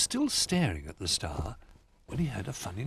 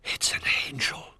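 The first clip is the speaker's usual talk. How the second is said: awed whisper. A narrator's reading.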